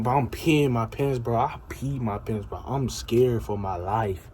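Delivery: usual speaking voice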